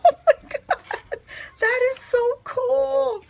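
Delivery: high, loud, laughing delight; laughing squeal. Real, not acted. Thanking a friend on camera, laughing high squeal. Laughing over the gift card.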